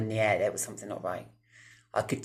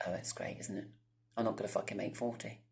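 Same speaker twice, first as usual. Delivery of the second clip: much softer, whispered sarcasm; sarcastic reply; 10 dB quieter than usual. Retold or imitated. Answering the doctor's warning, softly sarcastic. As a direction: mutter it back with dry sarcasm. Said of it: "And I was like".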